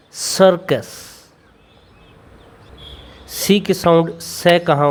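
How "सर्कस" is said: slow demo word. Shown as a demonstration. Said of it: "तो देखते हैं कै कहाँ पर बोलेंगे हम".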